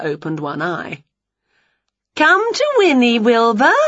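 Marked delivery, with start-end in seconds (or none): 2.2-3.8 high coaxing call. A narrator's reading. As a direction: coax the cat to come. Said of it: "called Winnie".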